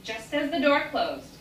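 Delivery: plain reading voice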